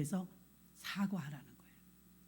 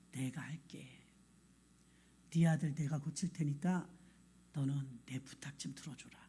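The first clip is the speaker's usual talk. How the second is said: soft voice of God; 7 semitones lower, 9 dB quieter than usual. Retold or imitated. God voiced speaking to father.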